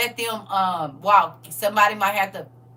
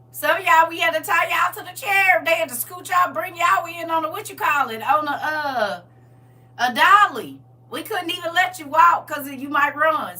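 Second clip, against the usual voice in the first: higher, louder, building; raised, playful preaching; teasing preach; 6 semitones higher, 6 dB louder than usual. Real, not acted. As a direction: stir up the congregation.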